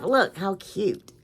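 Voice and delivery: ordinary talk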